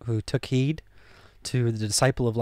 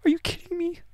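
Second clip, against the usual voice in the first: very high, soft incredulous squeak; quiet astonished squeak; hushed disbelief; 9 dB quieter than usual. Retold or imitated. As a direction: gasp it in disbelief under the breath. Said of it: "I was like".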